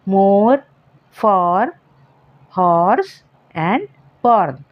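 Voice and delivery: मोर फॉर हॉर्स बॉर्न with an open or sound. Shown as a demonstration. Teacher showing students or words with an open or. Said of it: "अ की sound pronounce होती है".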